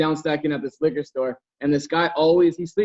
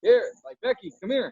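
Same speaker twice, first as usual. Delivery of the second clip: calling out; 7 semitones higher than usual. Retold or imitated. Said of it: "calling him and calling him".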